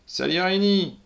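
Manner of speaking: loud, high call of his name. A narrator's reading. Policewoman calls out to the gunman, loud and high. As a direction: cry out his name to stop him. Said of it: "commence la femme".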